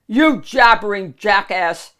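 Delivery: loud angry ogre yell; screaming. Acted. Shrek yelling at the rambling donkey, loud scream. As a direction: scream the insult. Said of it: "Shrek screamed".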